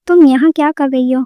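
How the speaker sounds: usual speaking voice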